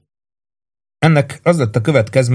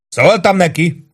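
slow, high shouted reply; shouting up; 8 semitones higher, slower than usual. A narrator's reading. Lieutenant shouting back up to the sergeant. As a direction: call back up to the roof. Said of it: "kiabált fel".

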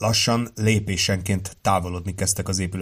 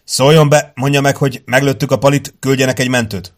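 loud, raised-pitch orders; urgent radio call; 5 semitones higher, 8 dB louder than usual. A narrator's reading. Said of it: "Davenport felszólt Haywoodnak". (hangos, sürgető segélykérés)

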